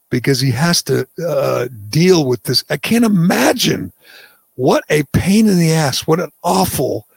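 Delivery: ordinary talk, nothing marked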